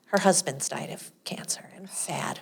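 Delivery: soft hushed whisper. Real, not acted. Telling her co-host about the husband's death, whispering. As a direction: hush it for the sad part.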